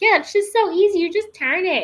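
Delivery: high, airy mock-casual; breezy online tutor. Imitating tutorial videos that make it sound easy, high and breezy. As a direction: mimic smug video guides. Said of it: "everyone's like".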